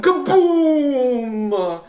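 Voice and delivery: loud high explosion word; a loud, high kaboom; big boom. A narrator's reading. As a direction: boom it out for the kids. Narrator voices the explosion.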